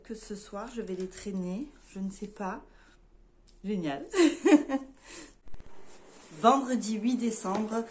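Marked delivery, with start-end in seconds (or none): 4.1-4.9 quick high laugh; short laugh. Real, not acted. Laughing to her viewers after the gift, short real laugh. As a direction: let a short giggle slip out.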